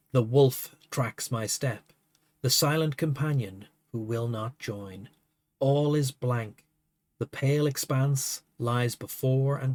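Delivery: poem reading. Acted. Reads a poem aloud.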